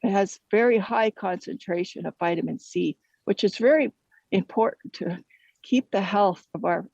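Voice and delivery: usual speaking voice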